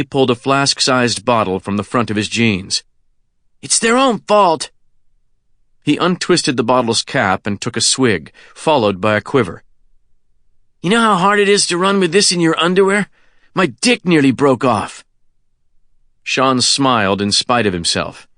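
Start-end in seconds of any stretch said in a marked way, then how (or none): 3.6-4.7 put-on high, defiant teenage boy voice; brash kid shrugging it off; cocky teen boy. Kenny voice continues. 10.8-15.1 put-on fast, high, complaining teen; cocky teen boy. Kenny voice, fast.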